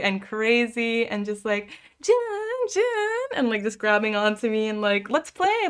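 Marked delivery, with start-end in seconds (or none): 2.0-3.3 put-on much higher, childlike calling of a name; imitating a clingy friend, high and childish; little kid calling. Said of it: "like a little kid". 5.1-5.7 put-on much higher childlike plea; little kid. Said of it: "like a little kid".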